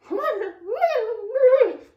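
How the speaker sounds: real high-pitched giggle; high laugh. Real, not acted. Laughing at the film.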